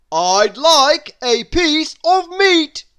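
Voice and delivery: loud, high, slow bellow; booming shout. A narrator's reading. Mister Noisy bellowing at the baker, loud and slow. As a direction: boom it across the shop. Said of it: "Mister Noisy shouted".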